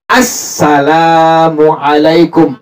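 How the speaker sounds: slow, "Assalamualaikum" with the lam drawn out; teacher modelling the long lam. Shown as a demonstration. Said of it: "Lamnya panjang".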